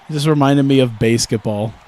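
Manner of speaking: ordinary talk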